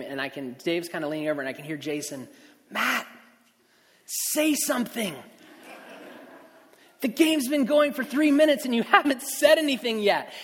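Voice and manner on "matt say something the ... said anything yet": urgent prompt. Retold or imitated. Director Jason in his earpiece, urgently pushing him to talk.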